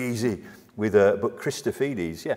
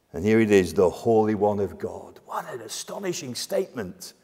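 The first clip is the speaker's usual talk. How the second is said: usual speaking voice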